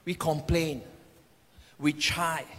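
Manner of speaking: ordinary talk, nothing marked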